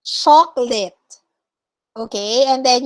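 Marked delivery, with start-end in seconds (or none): 0.0-0.9 chocolate said as "chok-lit"; chocolate modeled with no middle vowel; correct pronunciation demo. Shown as a demonstration. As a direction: say chocolate in two syllables. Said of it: "you say chocolate".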